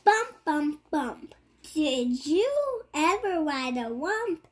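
normal talking voice

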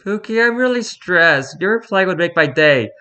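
high, sweet pleading voice; cutesy fan voice. Pretend. Reading a cutesy fan message.

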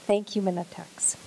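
soft whispered aside, dropping to a breath on the name; whispered thanks. Real, not acted. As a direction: murmur the thanks under your breath. Quiet aside thanking sponsor.

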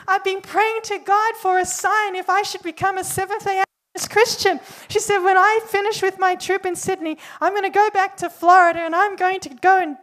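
excited outpouring. Retold or imitated. Traveller telling the preacher her sign came, excited and loud. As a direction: spill it out like an answered prayer.

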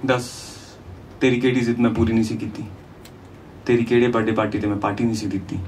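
ordinary delivery

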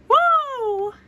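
long, very high "woo"; playful whoop. Pretend. Child cheering during play, long high whoop. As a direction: draw out a high-pitched whoop. Excited whoop while dancing.

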